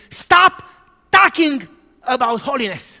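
high, loud angry yell; girl shouting at the preacher to stop. Retold or imitated. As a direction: burst out of the tent yelling.

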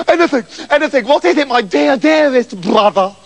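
high, desperate grovelling; fawning eagerness. Acted. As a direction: suck up to a brother, drawing out the ending. Septimus begging forgiveness.

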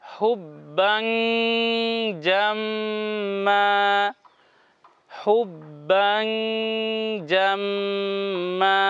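very slow, drawn-out recitation of হুব্বাং জাম্মা with the nasal ghunna held long; tajweed demo, হুব্বাং জাম্মা sung out slow and long; Quranic recitation. Shown as a demonstration. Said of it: "শব্দটি হলো".

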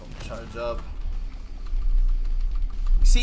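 usual speaking voice

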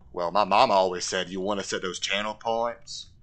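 put-on simple southern man's accent; Forrest Gump. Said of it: "I gotta talk like Forrest Gump".

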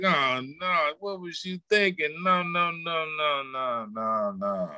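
high-pitched scolding, trailing down through the nos; mock dismay. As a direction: imitate an exasperated, let-down reaction. Acting out a disapproving God.

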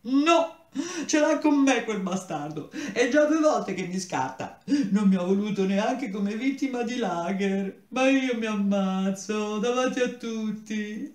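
tearful ranting. Acted. Rejected boy wails to the narrator about the serpent. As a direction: wail it out, slowing at the end. Boy rants, still in tears.

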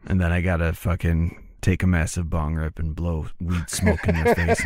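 ordinary talk

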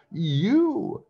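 very high drawn-out yell; shrieky storybook squabble yell; yelled retort. A narrator's reading. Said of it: "yelled Matey".

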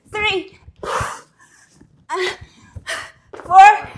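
loud rep count; counting reps loudly. Real, not acted. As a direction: call out each rep. Coach counting reps aloud.

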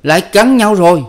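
loud shout. A narrator's reading. Adviser bursting in on the fighting couple, loud shout. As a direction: shout it from the doorway. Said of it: "hét inh lên".

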